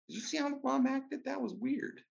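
mock high voice, quoting himself; high-pitched retelling. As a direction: squeak out the question in a high voice.

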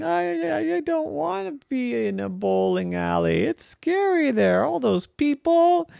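high, hesitant small voice near tears; a touch of fright, stammering; timid baby pin, lightly frightened; mildly scared little pin. Acted. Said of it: "She was almost crying".

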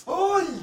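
loud high-pitched "ay!" yelp; mock high shout. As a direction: shriek it like the loud classmate. (grito agudo de burla)

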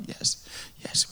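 a hushed, breathy yes from a broken man; quietly whispered agreement. Retold or imitated. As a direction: answer barely above a breath. Man answers softly before prayer.

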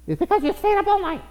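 very high put-on comic voice; imitated scolding in a tiny high voice; mock scolding. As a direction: squeal a mock reply. Acts out a scolding reply.

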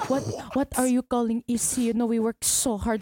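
put-on Filipino accent; indignant comic Filipino accent. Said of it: "Filipino accents is just so easy to do".

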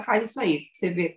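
ordinary talk, nothing marked

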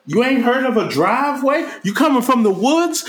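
put-on high, jeering voice of a teen bully; mock bully taunt. Podcaster mocking teen bullies, high jeering taunt. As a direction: sneer it up high like a cocky kid.

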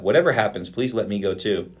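usual speaking voice

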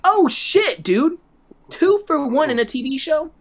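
high-pitched mock astonishment; amazed reaction. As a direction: act out a thrilled viewer's disbelief. Acts out viewer's amazed reaction.

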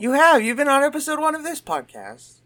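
high, loud mock outrage; loud outburst. Real, not acted. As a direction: call them out loudly. Loud correction of cohost.

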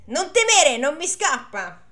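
high, loud reassuring shout; shouting back. Acted. Priest shouting back to the farmhand, loud. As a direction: call back that he's got him.

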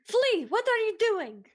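high, drawn-out scolding. Acted. Catching Flea dumping food behind the couch, scolding. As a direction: catch them in the act, pitched high and slow.

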